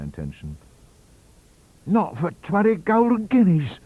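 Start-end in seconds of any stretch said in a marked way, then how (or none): 1.9-3.8 high, loud indignant refusal; pathetic pride. A narrator's reading. The girl rebuffing the shepherd, proud and indignant, high and loud. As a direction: draw yourself up with wounded pride. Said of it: "with pathetic pride".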